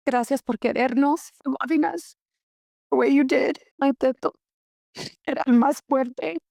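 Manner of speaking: high, trembling tearful farewell; fighting back tears, voice cracking; voice breaking with tears. Real, not acted. Tearful farewell to late grandfather.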